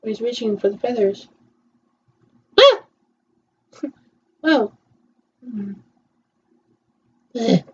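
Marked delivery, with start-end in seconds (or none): none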